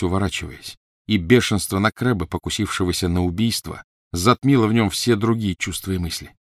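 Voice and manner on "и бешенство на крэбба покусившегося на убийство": plain reading voice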